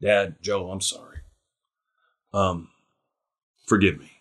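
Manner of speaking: sheepish apology. Retold or imitated. Imagined boy Jesus apologizing to his parents, low sheepish mumble. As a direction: hang your head like a caught boy, low and quiet. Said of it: "would have said".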